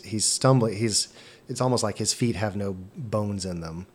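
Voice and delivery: ordinary talk, nothing marked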